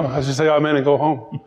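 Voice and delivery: ordinary talk, nothing marked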